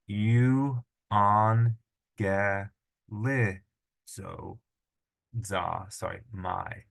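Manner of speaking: you on ge li za my, each syllable split apart. Shown as a demonstration. Teacher splitting a long word into syllables. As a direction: break it into separate syllables. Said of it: "You on ge li za my".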